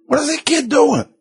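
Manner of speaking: high-pitched indignant outburst; angry, frustrated. Acted. Preacher acts someone kicked in the shin by a child, indignant. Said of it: "Angry, you get frustrated".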